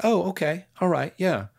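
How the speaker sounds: lightly voiced, soothing self-encouragement; kind self-talk. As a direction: comfort your stressed self.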